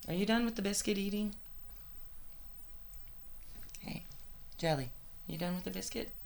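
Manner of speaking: high, gentle pet talk. Real, not acted. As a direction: coax the dog kindly. Talking to dog Jelly.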